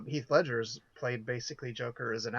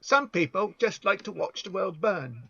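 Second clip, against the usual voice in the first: a lightly voiced, bad Cockney accent as Michael Caine; half-hearted Cockney impression, a touch higher; 6 semitones higher, 5 dB louder than usual. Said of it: "that was a really bad Cockney accent".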